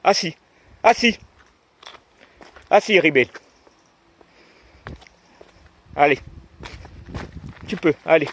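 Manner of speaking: sharp, loud, high command; talking to a dog. Real, not acted. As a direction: stop the dog firmly. Stopping the dog at trail fork.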